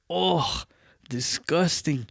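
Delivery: a high, grossed-out oh. Retold or imitated. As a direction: pull back from the smell, grossed out. Smelling dad's alcohol breath.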